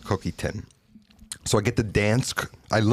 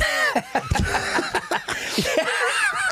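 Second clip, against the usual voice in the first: big laugh. Real, not acted. Delighted laugh at food memory.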